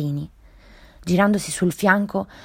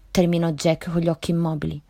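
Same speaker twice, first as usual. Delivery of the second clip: usual speaking voice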